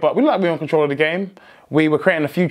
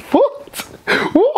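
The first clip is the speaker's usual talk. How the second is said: sudden, much higher disbelieving yelp; shocked squeal. Real, not acted. Reacting to his own words.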